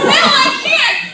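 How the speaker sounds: high, loud, drawn-out objection; mock protest. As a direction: wail the refusal, half laughing. Retold protest to fellow actor.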